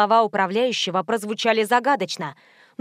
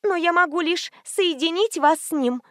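put-on high-pitched timid girl; frightened babble; 7 semitones higher than usual. A narrator's reading. Operator explaining herself to the angry caller, frightened. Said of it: "испуганно пролепетала девушка".

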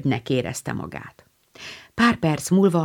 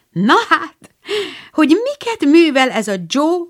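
much higher, louder astonished exclaim; surprised; 10 semitones higher, 4 dB louder than usual. A narrator's reading. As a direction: gasp it out in amazement. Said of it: "meglepetésében".

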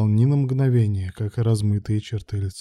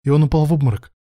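higher, louder nervous addition; nervous gabble; 5 semitones higher, 5 dB louder, faster than usual. A narrator's reading. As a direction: add it in an agitated rush of nerves. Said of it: "нервно затараторил Илья". (нервно, взволнованно)

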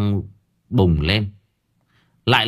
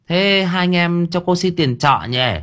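landlady collecting rent; 8 semitones higher than usual. A narrator's reading. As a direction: play the landlady asking for rent, pitched well up. Landlady speaks in the story.

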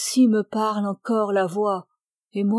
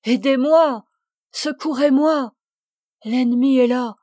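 higher, louder acted plea for help; crying out; 3 semitones higher, 5 dB louder than usual. A narrator's reading. Dying man begging the priest for help, crying out. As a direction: cry out urgently for rescue. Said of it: "cria-t-il".

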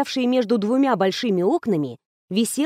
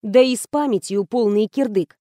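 ordinary delivery, nothing marked

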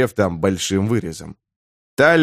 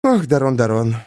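sad sigh. A narrator's reading. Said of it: "грустно вздохнула вампирша".

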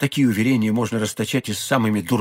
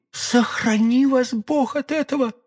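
much higher, slow, anxious woman's cry; alarmed plea; 11 semitones higher, slower than usual. A narrator's reading. Young woman warning d'Artagnan, anxious and high. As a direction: beg him in alarm. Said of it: "в голосе её прозвучала такая тревога".

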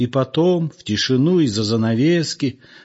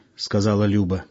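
ordinary delivery, nothing marked

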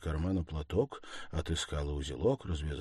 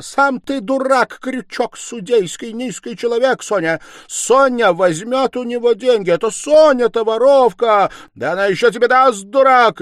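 high, loud, furious insults; hysterical tirade; 18 dB louder than usual. A narrator's reading. Stepmother insulting Sonya's accuser, hysterical shouting. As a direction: rant at full pitch. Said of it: "истерически захохотала".

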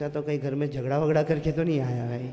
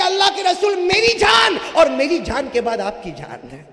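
impassioned preacher's climb; fiery sermon; 10 semitones higher, 10 dB louder than usual. Acted. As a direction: drive it home to the crowd. Story told at full force.

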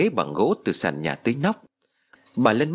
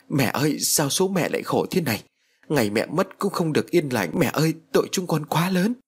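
wailing lament; 6 semitones higher than usual. A narrator's reading. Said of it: "mà gào khóc".

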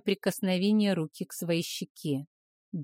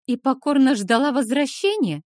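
openly mocking question, higher and louder; 6 semitones higher, 6 dB louder than usual. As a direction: scoff at the idea. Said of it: "не скрывая насмешки".

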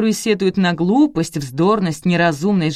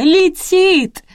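very high, loud, stretched-out wail; piercing long cry, falling at the end; shrieking yell; 13 semitones higher, 7 dB louder than usual. A narrator's reading. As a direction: scream the warning at the top of your pitch. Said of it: "завопила Рина".

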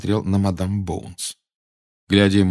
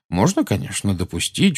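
ordinary delivery

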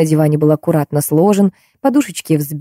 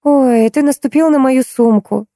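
higher-pitched, lightly outraged complaint; mild indignation; 7 semitones higher than usual. A narrator's reading. Wife to husband who stepped on her bag, mildly indignant. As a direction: protest with a touch of indignation. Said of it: "возмутилась Юля".

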